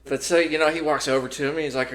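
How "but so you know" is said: usual speaking voice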